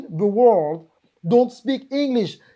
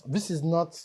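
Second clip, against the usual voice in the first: ordinary delivery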